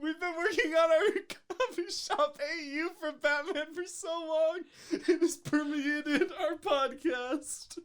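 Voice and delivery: high laughing through words. Real, not acted. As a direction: talk while cracking up. Laughing hard.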